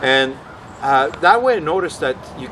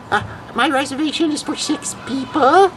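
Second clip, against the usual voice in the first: put-on very high, quavering old lady voice; little old lady. Prank caller phoning a restaurant as a little old lady. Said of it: "little old lady voice".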